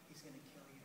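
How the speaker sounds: very hushed, low warning whisper; barely audible secret warning. Acted. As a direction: whisper it into a friend's ear. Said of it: "he whispers in my ear".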